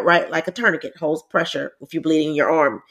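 ordinary talk

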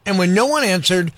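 lively read-aloud storytelling; story narration. A narrator's reading. Reading a story to kids.

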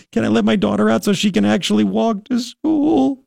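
mock weepy plea; mock crying voice. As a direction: imitate her tearful plea, half-hearted. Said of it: "And she's crying".

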